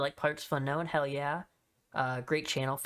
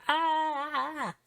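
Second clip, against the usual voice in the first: mock screaming; 12 semitones higher than usual. Fan imitating the screaming crowd at a divas match, mock high screams. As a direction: imitate the crowd's shrill shrieks. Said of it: "They were just screaming".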